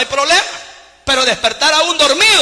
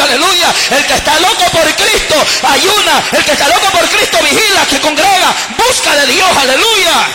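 loud, driving preacher's cry; shouted gospel exhortation; fiery preaching; 3 semitones higher, 5 dB louder than usual. Acted. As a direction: preach it with fire, hammering each line. Preaching at full force.

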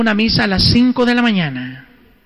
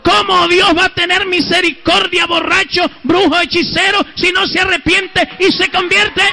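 high, forceful rhetorical challenge; indignant preaching shout; 8 semitones higher than usual. Real, not acted. Preacher challenging drunkards and sorcerers to repent, indignant shout. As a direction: cry out the rebuke at a high pitch. Preacher rebukes the prayer he just intoned.

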